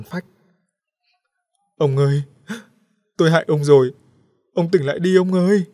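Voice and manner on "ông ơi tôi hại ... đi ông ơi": loud, high wailing plea; sobbing and begging an unconscious husband; wailing over husband. A narrator's reading. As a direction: cry out to wake him. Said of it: "khóc ngất lên".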